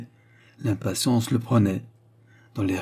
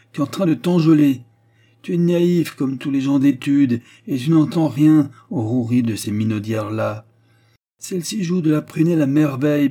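higher, louder scornful tirade; brusque; 5 semitones higher, 5 dB louder than usual. A narrator's reading. Professor warns naive pupil about women, brusque. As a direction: rail against these flirts. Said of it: "interrompit brutalement le professeur".

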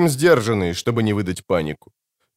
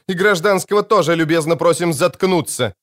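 higher-pitched, mock-polite command; barked order; 7 semitones higher than usual. A narrator's reading. Warden telling the civilian to shut up, barked mock politeness. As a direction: keep barking, with pointed courtesy.

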